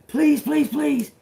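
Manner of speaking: high, rapid imitated begging; mock pleading. Passenger begging driver to spare dad, mock pleading.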